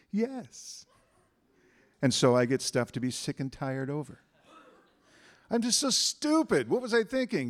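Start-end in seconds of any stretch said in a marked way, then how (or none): 0.1-0.8 high, drawn yes; universe's voice. Acted. Universe answering a complaint, high obliging yes. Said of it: "Universe says". 5.5-7.5 high, loud-edged self-reproach; mock self-berating. Someone scolding themselves before the universe answers, mock self-berating. Acting out negative self-talk.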